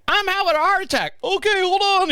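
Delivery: high-pitched mock caller and dispatcher; high, comic call-in voice; acted emergency call. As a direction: pitch it way up like a hammed-up 911 call. Retold panicked emergency call.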